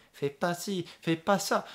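French: voix d'enfant qui chantonne; little French kid. Retold or imitated. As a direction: sing like a small child. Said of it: "little kid singing".

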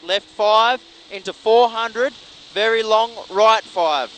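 loud, urgent co-driver calls; rapid rally pace notes. Real, not acted. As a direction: call the corners fast and loud.

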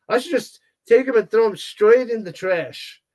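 ordinary talk, nothing marked

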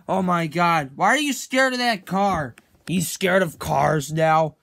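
ordinary talk, nothing marked

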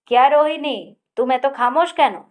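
Akash calls out loud and high; calling out. A narrator's reading. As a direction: hail her jovially across the table. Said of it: "আকাশ হেঁকে উঠল".

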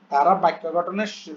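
usual speaking voice

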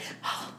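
sharp unvoiced gasp. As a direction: suck in a startled breath. Touches statue, shock.

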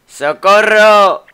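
long, drawn-out, high shout for help; a call for help stretched slow and loud; Luigi's cry for help. Acted. A trapped brother shouting for rescue, slow loud cry. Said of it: "con la voz de Luigi".

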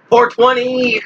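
high, drawn-out four twenty call; meme callout. Acted. Gamer hyping teammates with a high meme call. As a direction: belt out the meme number.

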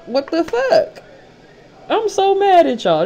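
very high, loud exasperation; high outraged cry. Real, not acted. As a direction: shriek your frustration. Outraged rant.

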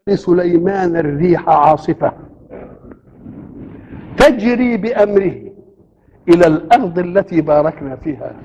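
slow, measured chanted recitation; Quran recitation. Acted. Preacher recites the verse on Solomon's wind, slowly chanted. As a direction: draw out each word of the verse.

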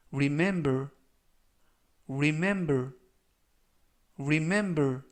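remember with mem stressed; stress on the middle syllable. Shown as a demonstration. Teacher modelling remember for the class, stress on mem. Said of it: "강세는 가운데 부분에 있는 알파벳 E에 강세".

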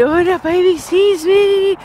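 delighted, slow and high; excited. Real, not acted. Spots a baby on the street.

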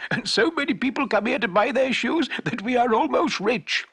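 shoemaker's wife rejoicing over their new fortune; happy wife. A narrator's reading. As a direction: burst out with delight. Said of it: "said the shoemaker's happy wife".

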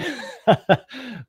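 real amused laugh, high and bright; high burst of laughter. Real, not acted. Laughs at own story's end.

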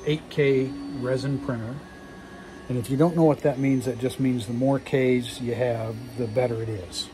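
usual speaking voice

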